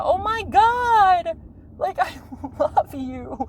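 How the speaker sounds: high, drawn-out adoring wail; overcome gushing. Retold or imitated. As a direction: gush slowly, breaking into a laugh.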